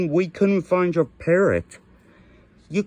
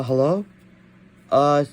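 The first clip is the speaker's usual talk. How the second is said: ordinary talk, nothing marked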